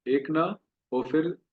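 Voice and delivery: usual speaking voice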